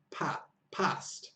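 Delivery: "past" with short northern A; northern English accent. Retold or imitated. Teacher showing how the northern friend talks, northern English accent. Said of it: "she's from the north, so she says".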